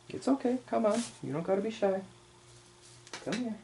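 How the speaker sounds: high, soft coaxing, like calling a shy kitten; coaxing pet voice. Acted. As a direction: gently lure the little creature closer, pitched well up.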